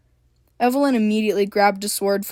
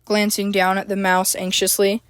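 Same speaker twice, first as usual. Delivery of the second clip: ordinary delivery, nothing marked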